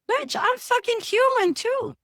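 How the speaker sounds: high, sassy comeback; indignant retort. Retold or imitated. Therapist telling a client she is human too, sassy and high. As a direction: snap back at the client.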